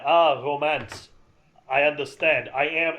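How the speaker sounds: mock French accent, slow and loud. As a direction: ham up a French waiter's accent. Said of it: "He speaks in a French accent".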